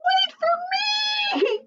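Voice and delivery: very high, slow, desperate shout; crying out. Acted. Bird falling behind calls to the others, shrieking. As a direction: shriek it as she falls behind. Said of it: "cried Looney Little".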